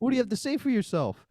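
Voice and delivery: high falsetto principal; school principal. Acted. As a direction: put on a squeaky-high voice as the principal. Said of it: "the principal of the school".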